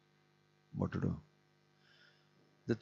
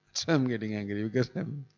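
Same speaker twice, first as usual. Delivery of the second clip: laughing. Real, not acted.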